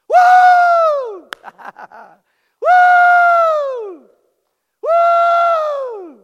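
loud, very high whooping shout; joyful whoop. Real, not acted. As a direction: whoop with full-throated joy. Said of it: "Hold your ears".

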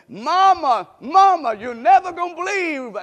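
put-on high, loud, breathless boy calling mama; excited little boy. Little boy running home to tell his mama, excited.

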